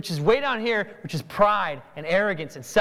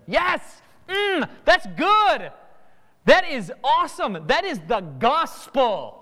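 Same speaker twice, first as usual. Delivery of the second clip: excited; 4 semitones higher, 4 dB louder, slower than usual. Acted. Acted friend delighted by lunch invite.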